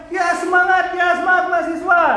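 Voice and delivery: imitated high-pitched cheer; cheering onlookers. Residents cheering student protesters on the bus, high imitated cheer. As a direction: call out encouragement like a passing crowd.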